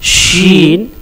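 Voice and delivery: शीन with a whistling sh; letter demo. Shown as a demonstration. Teacher showing students the letter, whistling sh. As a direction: round the tongue up and hush out शीन. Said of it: "इसका तलफ़ुज़ है".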